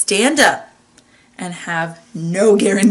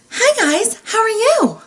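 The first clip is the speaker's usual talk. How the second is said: much higher, put-on work greeting; weird work voice; 13 semitones higher, 4 dB louder, slower than usual. Host mocking the voice they used at work, weird high work voice. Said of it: "that weird work voice I was using".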